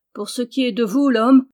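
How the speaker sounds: a touch rude, putting him in his place; rough rebuff. A narrator's reading. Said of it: "dit-il rudement". (ton rude, un peu plus fort)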